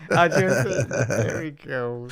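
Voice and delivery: laughing. Real, not acted. Laughing at own reactions.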